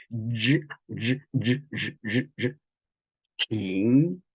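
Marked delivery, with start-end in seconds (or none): 0.1-2.6 "zhi" repeated with the tongue curled up; pronunciation demo. Shown as a demonstration. Teacher showing students how "zhi" is pronounced. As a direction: say "zhi" over and over, tongue bent upward. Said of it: "La lengua se dobla hacia arriba cuando se pronuncia".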